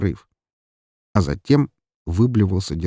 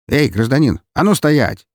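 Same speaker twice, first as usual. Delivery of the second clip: high, loud police challenge; shouted order; 6 semitones higher, 5 dB louder than usual. A narrator's reading. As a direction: call out sharply to halt someone. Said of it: "крикнул один из полицейских".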